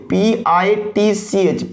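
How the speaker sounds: पी आई टी सी एच said slowly, one letter at a time; spelling out letters. Shown as a demonstration. Teacher spelling pitch for the class, letter by letter. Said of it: "जो पिच".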